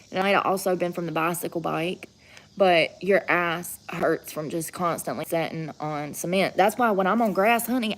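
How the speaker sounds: ordinary talk, nothing marked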